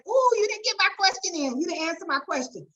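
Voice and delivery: hammed-up gripe of someone left out; imitating a complaining viewer. Host mimicking webinar viewers whose questions went unanswered, mock whiny.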